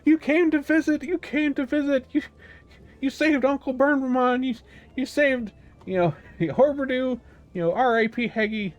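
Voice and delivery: very high, gushing, tumbling over the words; excited little creatures. Acted. Villagers greet their heroes, gushing in very high voices. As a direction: squeak it out like eager tiny fans. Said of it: "and they're like".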